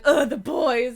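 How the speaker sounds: loud mock disgust; voicing a cat. Voicing Lily sitting over there, mock disgust. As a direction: groan it out as a fed-up cat. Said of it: "being like".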